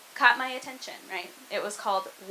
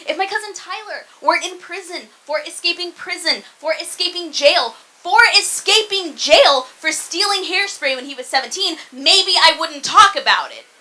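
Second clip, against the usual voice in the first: high, insistent rant building louder; exasperated rant; 6 semitones higher, 8 dB louder than usual. Acted. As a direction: pile up the list, getting louder to the end. Escalating rant about cousin's crimes.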